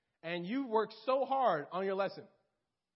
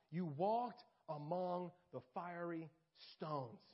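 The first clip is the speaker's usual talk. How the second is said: slow, measured reading of scripture; 3 semitones lower than usual. Acted. Preacher reading Ezekiel to his congregation, slow and solemn. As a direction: read it out solemnly, word by word.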